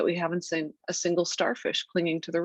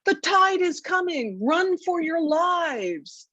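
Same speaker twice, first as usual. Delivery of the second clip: high, drawn-out mock yell of panic; child's alarmed yell; 12 semitones higher, slower than usual. A narrator's reading. Mother imitating her small son warning his friends, high drawn-out yell. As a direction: cry the alarm, stretching the words out high. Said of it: "yelling to his new friends".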